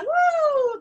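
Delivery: high airy head-voice woo; breathy high woo. Shown as a demonstration. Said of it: "that airy place".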